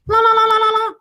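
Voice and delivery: high, loud trilled ringing noise; phone ring imitation. Storyteller breaks in with the ringing phone, shrill trill. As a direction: mimic an old telephone ringing.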